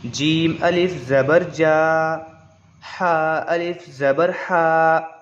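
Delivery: slow, measured spelling out, each vowel stretched; slow demo reading. Shown as a demonstration. Teacher reads a line aloud for students, slow and stretched. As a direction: read the line slowly for the class. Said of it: "एक line आपको पढ़ के बता देते हैं".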